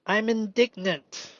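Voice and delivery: ordinary talk, nothing marked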